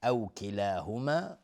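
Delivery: ordinary talk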